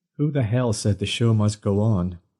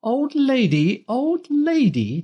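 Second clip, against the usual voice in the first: very high, slow, louder screech; outraged screech; 3 dB louder, slower than usual. A narrator's reading. Dinah screeching at being called an old lady, outraged screech. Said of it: "Dinah screeched her outrage".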